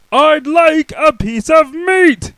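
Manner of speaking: very slow loud high shout. Acted. Mister Noisy shouting at the butcher. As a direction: shout every word long. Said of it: "Mister Noisy shouted".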